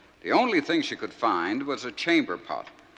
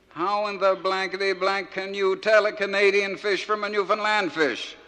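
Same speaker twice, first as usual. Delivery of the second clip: skipper yelling; 9 semitones higher, 5 dB louder than usual. Acted. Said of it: "He yelled and he bawled and he stormed".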